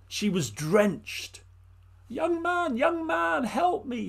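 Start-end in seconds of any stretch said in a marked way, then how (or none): 2.1-4.1 put-on high, pleading old woman's voice; an old woman begging for help in the rain; high, pleading old woman calling out; old woman crying out. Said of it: "she cried".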